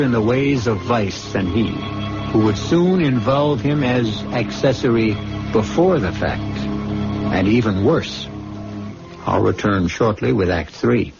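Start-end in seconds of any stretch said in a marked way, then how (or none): none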